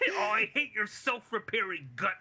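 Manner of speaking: high, half-laughing retort of hatred; mock mad. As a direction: play furious while amused. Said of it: "Deadpool gets mad".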